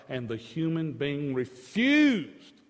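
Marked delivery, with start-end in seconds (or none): 1.3-2.6 high, loud, rising to a shout; fiery pulpit climax ending in a shouted why; impassioned preaching. Real, not acted. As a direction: cry out in exasperation.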